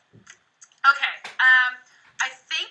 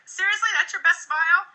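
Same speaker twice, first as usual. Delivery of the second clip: teasing; 6 semitones higher, 3 dB louder than usual. Shown as a demonstration. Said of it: "I will tease a little bit".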